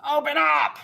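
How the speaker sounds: high, loud shout; yelling through door. Acted. Bandits outside to shopkeeper, yelling through the doors. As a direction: yell it from outside the shop. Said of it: "You hear yelling through the doors and windows".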